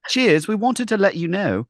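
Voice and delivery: high, prim mock British accent; mock British accent reading the marathon email. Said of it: "prim and proper".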